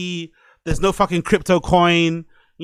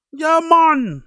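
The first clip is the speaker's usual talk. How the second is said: imitating Geordie accent; 10 semitones higher than usual. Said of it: "the Geordie accent's fucking hilarious".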